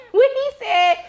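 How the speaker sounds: talking through laughter, high; can barely get it out for laughing; real laughter breaking the words. Real, not acted. Laughing while recounting the scene.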